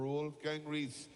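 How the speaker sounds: slow, stretched announcer name call; drawn-out name call. Real, not acted. Commentator calls the Austrian shearer's name, slowly stretched. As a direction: draw the name out for the crowd.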